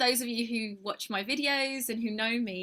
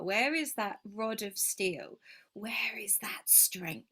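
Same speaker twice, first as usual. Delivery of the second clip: whispered questions; 2 semitones lower, slower than usual. Real, not acted. Searching inner questions.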